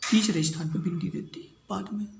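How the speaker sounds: soft unvoiced whisper to himself. Real, not acted. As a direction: murmur it under the breath while writing. Whispered aside while writing.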